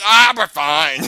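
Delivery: loud, brushed-off reassurance; breezy dismissal. Retold or imitated. Retelling the reply to a warning, loudly dismissive. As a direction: wave the worry away loudly.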